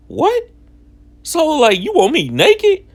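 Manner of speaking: a voice cracking up high in disbelief; incredulous. Retold or imitated. Mom demands everything off.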